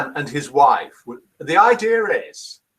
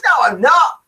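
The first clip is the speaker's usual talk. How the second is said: loud, high protest; playful protest; 10 semitones higher, 7 dB louder than usual. Real, not acted. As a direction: shout back a jokey denial. Jokey denial to a listener.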